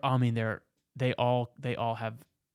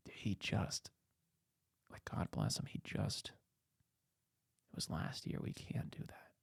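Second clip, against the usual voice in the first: imitating inspectors in a near-whisper; hushed reluctance; 5 semitones lower, 11 dB quieter, slower than usual. Inspectors conferring about a recent death.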